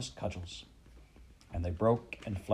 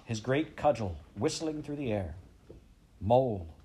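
plain reading voice

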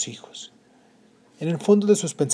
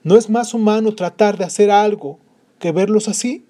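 pleading father; 7 semitones higher, 7 dB louder than usual. Acted. Father voiced in story.

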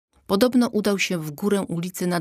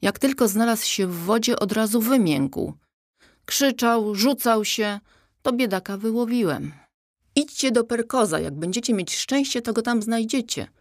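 higher-pitched, easygoing toad voice; laid-back toad; 6 semitones higher than usual. A narrator's reading. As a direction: play a casual toad reassuring someone.

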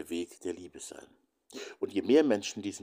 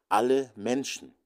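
"alle Menschen" drawn out, higher and louder; emphatic insistence; 5 semitones higher, 6 dB louder than usual. Real, not acted. Speaker insists brothers, sisters and siblings all count, emphatic. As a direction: lean slowly and loudly on "alle Menschen".

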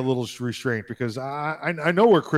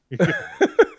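squealing laugh, much higher; 5 dB louder than usual. Real, not acted. High laugh at the Siri story, much higher.

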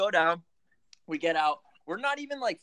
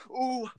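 pained ooh; 9 semitones higher than usual. Retold or imitated. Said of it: "like the real deep breath".

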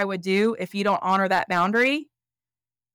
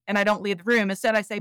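ordinary talk, nothing marked

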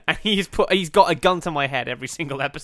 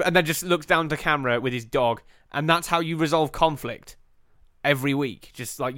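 ordinary talk

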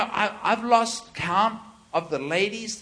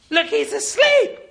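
much higher, louder cry of disbelief; incredulous panic; 12 semitones higher, 4 dB louder than usual. Retold or imitated. Disciple pointing at sleeping Jesus, shrieking disbelief.

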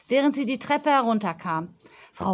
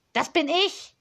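put-on higher, bossy little girl complaining into the phone; a pampered child scolding a supplier; spoilt girl on phone; 7 semitones higher than usual. Spoilt girl scolding the supplier on the phone, higher and bossy.

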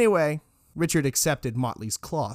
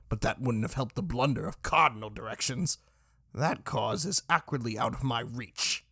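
softer smoke-wrecked rasp; smoke-ravaged voice; 5 dB quieter than usual. A narrator's reading. Irked speaker behind the door, horrible smoke-ravaged voice. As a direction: grumble through a smoke-ravaged throat, softly. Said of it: "said a horrible, smoke-ravaged voice".

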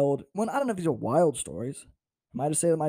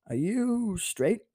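drawn-out, high YOU, rising at the end; hesitant probing question; 7 semitones higher, much slower than usual. Retold or imitated. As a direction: stretch YOU way up, as if unsure. Date's hesitant, awkward question retold.

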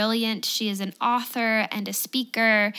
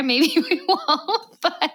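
slow, higher, laughing through the words; 7 semitones higher, slower than usual. Real, not acted. Host teasing her guest about secrets, laughing. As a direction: tease with a giggle.

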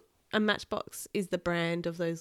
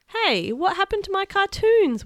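put-on high whining kid voice, much higher; whiny kid; 14 semitones higher, 8 dB louder than usual. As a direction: whine it like a grumpy little boy. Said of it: "whined Kenny".

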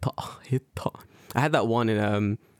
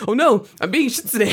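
high and loud panicked cry; 10 semitones higher, 7 dB louder than usual. Retold or imitated. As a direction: blurt it out in a panic. Quoting panicked sparring partner.

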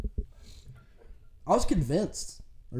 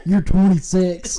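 laughing; 6 semitones higher, 12 dB louder, slower than usual. Real, not acted. Teasing through laughter.

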